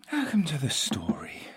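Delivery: high whispered story intro. Shown as a demonstration. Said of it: "such a big pause there".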